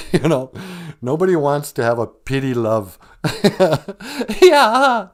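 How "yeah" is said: bright laugh then a loud laughing yeah. Real, not acted. Cohost laughing along at the crying advice.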